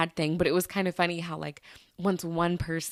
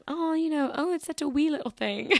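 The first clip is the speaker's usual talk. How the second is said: high-pitched, dainty attempt at a Scottish accent; lightly put-on Scottish lilt, pitched up; playful Scottish accent; 9 semitones higher than usual. Podcaster trying out the word wee herself, high mock-Scottish accent. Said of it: "you gotta say it with the accent".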